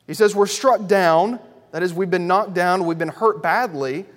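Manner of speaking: loud, ringing pulpit cadence, line after line; preaching at full force. Acted. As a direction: build the list like a revival preacher. Preacher drives through Paul's list.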